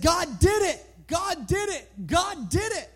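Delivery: high, slow jubilant cries; excited. Acted. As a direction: shout the praise out with joy. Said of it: "She's excited, ecstatic".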